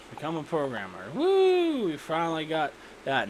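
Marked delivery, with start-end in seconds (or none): 1.1-1.9 high-pitched, held "woo" of celebration; high cheering woo. Real, not acted. Player finally gets a programmer, high cheering whoop.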